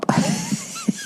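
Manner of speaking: quick squeaky chuckle; a brief falsetto laugh; short high laugh. Real, not acted. Laughs at own story.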